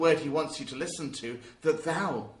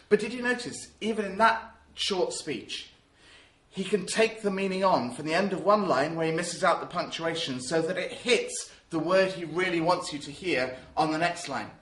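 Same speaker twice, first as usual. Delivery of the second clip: normal talking voice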